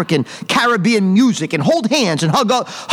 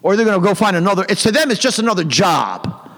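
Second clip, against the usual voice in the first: ordinary delivery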